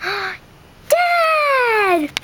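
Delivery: high gasping call of joy; overjoyed to see dad; joyful cry. Acted. Duckling crying out joyfully on finding dad. Reunion shout.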